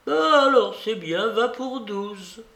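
put-on high-pitched old lady giving in; old babouchka, conceding. A narrator's reading. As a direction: agree grandly, slowing at the end.

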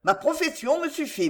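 brusque refusal starting high; abrupt interruption. A narrator's reading. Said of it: "Il interrompit brusquement".

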